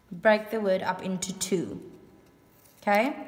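usual speaking voice

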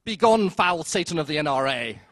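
loud, hammed-up denunciation; mock denouncing cry. People denouncing Heston, loud hammed-up cry. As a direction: cast him out like a preacher. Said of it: "many people would have gone".

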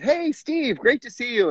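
lightly voiced cheerful sitcom girl; D J's greeting. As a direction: pitch it way up like a cheery sitcom hello. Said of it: "D J says".

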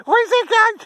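egging the dog on while he runs; excited high pet voice. Real, not acted. Excited owner cheering on dog.

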